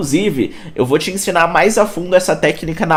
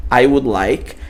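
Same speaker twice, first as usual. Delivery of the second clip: I would like an aisle seat, slow and careful, aisle as one syllable; slow teacher demo; slow model sentence; 2 semitones lower, slower than usual. Shown as a demonstration. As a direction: model it slowly for the class. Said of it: "vou pedir".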